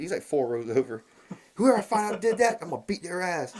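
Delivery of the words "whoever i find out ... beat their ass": high-pitched mock threat; retold angry threat. Angry stranger threatens whoever farted, high mock threat. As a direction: imitate an outraged stranger swearing revenge. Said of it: "this guy starts yelling".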